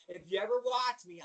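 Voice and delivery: ordinary talk, nothing marked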